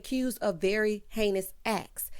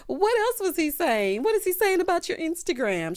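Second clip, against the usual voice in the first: eager Kyle; 9 semitones higher, 4 dB louder than usual. Retold or imitated. Kyle prodding Erica about her son, eager and higher. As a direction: lean in, hungry for gossip. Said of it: "Kyle is like".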